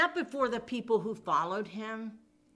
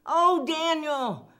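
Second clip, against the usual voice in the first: acted woeful call, raised high; lamenting call; 6 semitones higher, 5 dB louder, slower than usual. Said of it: "a lamentable voice".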